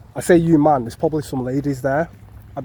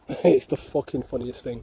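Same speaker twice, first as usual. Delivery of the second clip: normal talking voice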